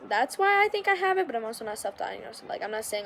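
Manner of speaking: usual speaking voice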